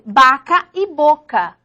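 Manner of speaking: vaca and boca said slowly, the v and b sounding the same; teacher demo that vaca and boca share one b sound; slow, careful demo. Shown as a demonstration. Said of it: "la B y la V".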